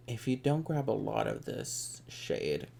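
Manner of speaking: usual speaking voice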